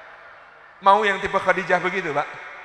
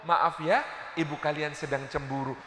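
gentle apology; 5 semitones lower, 8 dB quieter than usual. Retold or imitated. Prophet apologises calmly to guests.

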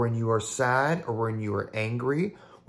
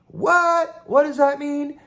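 much higher, playful baffled voice; mock confused question; 14 semitones higher, 5 dB louder, slower than usual. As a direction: play the puzzled listener. Mock puzzled listener asks back.

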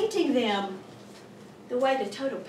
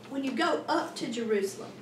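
normal talking voice

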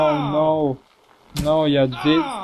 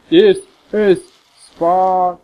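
mock Sparta shout; 3 semitones higher, 6 dB louder, much slower than usual. Shouting the Sparta meme quote.

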